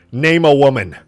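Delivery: loud insistent mic question; pushy street interviewer. Retold or imitated. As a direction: shove the mic in their face. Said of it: "with a microphone and you're like".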